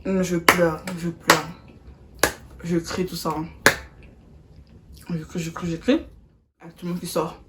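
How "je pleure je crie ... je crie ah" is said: ordinary talk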